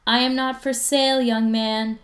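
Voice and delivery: mock-stern mom calling across the house; calling back. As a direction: call it from down the hall. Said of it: "his mom calls back".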